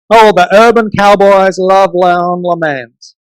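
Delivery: slow drawled cowboy accent title. Shown as a demonstration. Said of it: "I'm gonna try and sound like, a little like a cowboy".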